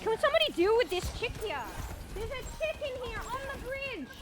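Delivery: high-pitched alarmed plea; urgent calling out. Real, not acted. Gamer asking team to deal with an enemy, urgent calling. Urgent call for team's help.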